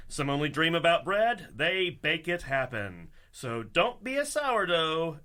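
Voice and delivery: ordinary delivery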